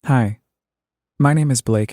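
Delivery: ordinary delivery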